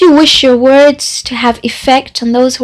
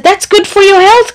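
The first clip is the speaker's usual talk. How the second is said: cheerful cry, pitched much higher; cried out; 8 semitones higher than usual. A narrator's reading. Deaf visitor cheerfully cries out to the sick man. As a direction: call it out brightly, pleased with himself. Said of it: "cried the visitor".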